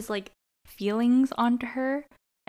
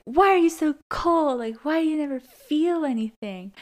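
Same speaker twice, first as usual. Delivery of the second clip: raised, higher voice in mock confrontation; accusing outburst; 6 semitones higher, 5 dB louder than usual. Host acting out Finn confronting Quinn, accusing outburst. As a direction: act out a pained, loud accusation.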